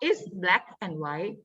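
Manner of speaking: slow, soft reading of the clue; drawn-out riddle clue; slow teacher clue. Shown as a demonstration. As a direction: read each word slowly for the class. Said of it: "นี่ถ้าเกิดคุณครูบอกว่า".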